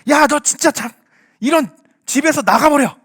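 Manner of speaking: loud, high-pitched parental outburst; angry scolding. Acted. Parent throwing a child out, angry scolding. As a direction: scold like a parent at the end of patience. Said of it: "정말 화가 나서 막 애를 혼내면서".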